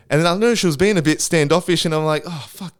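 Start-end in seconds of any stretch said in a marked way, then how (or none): 2.3-2.8 muttered curse. Retold or imitated. Realising she's standoffish.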